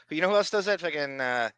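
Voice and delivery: usual speaking voice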